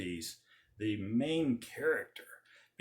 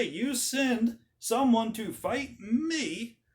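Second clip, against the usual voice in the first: higher, slow, booming dare; giant's challenge; 9 semitones higher, 4 dB louder, slower than usual. Retold or imitated. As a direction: lay out the terms like a boastful giant. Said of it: "He would say".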